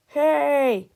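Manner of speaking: loud, high call across the stands; shout. Acted. Fan in the stands shouts in outrage, loud high holler. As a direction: holler to get everyone's attention. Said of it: "shouted a fan in the stands".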